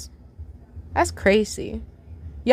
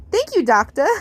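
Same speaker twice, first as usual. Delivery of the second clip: mock doctor voice; 3 semitones higher than usual. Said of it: "You put on a voice impression".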